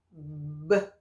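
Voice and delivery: B said as a soft lip pop, no vowel after it. Shown as a demonstration. Teacher showing children the B sound as a little lip pop. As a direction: pop the lips for B like a tiny burst. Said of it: "O B faz assim".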